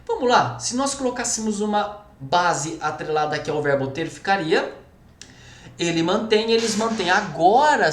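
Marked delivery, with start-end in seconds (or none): none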